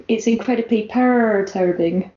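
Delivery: "perturbing" with its first syllable drawn out into a cat's purr. Shown as a demonstration. Co-host correcting the reading, purring the "per" in "perturbing". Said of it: "Gary, you read it wrong".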